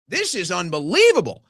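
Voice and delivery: ordinary delivery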